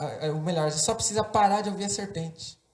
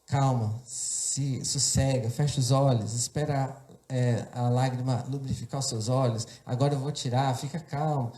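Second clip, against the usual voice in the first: low, calming step-by-step instructions; guiding mother; 3 semitones lower than usual. Retold or imitated. Mother guiding her son as she removes the splinter, low and soothing. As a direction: talk a frightened child through it steadily. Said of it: "voz da minha mãe me orientando".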